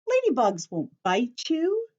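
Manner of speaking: usual speaking voice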